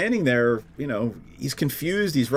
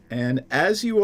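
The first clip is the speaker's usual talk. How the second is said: ordinary talk, nothing marked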